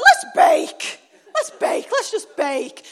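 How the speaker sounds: loud, high burst that eases off; eager exclamation. Retold or imitated. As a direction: suggest baking with sudden mock enthusiasm. Rallying the kids to bake.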